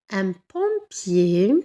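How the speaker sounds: very slow "pompier", ending "-ier" drawn out; teacher modelling the masculin ending slowly; slow teaching demo. Shown as a demonstration. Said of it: "qui se termine par I E R".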